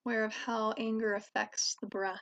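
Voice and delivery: calm, even voice with long pauses; guided meditation. Real, not acted. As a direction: guide listeners gently inward, leaving space between phrases. Leads a meditation.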